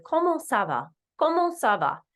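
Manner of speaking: Comment ça va said as French kohmoh sah vah; French phrase. Shown as a demonstration. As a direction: model Comment ça va for students to repeat. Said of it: "we will start with".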